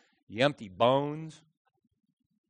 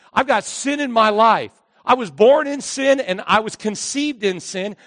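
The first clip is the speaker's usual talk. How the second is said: raised, high preaching voice; 6 semitones higher, 6 dB louder than usual. Acted. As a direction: drive the point home to the congregation.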